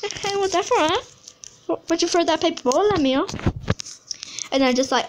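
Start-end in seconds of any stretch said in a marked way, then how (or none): 0.0-3.3 higher, taunting bully voice; mock the bully who threw paper; voicing the bully's taunt, higher and mocking; lightly voiced bully.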